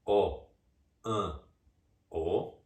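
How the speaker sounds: O, An, O said as separate vowel sounds; O, An, O, one vowel at a time with pauses; isolated vowels sounded out. Shown as a demonstration. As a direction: sound out each vowel alone: O, An, O. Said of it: "tiram as vogais do nome, ou seja".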